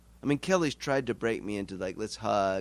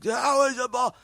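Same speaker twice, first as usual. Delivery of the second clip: mock fan yell; 13 semitones higher, 7 dB louder than usual. Fans yelling at umpire.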